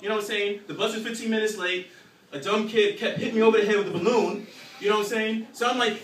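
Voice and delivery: ordinary talk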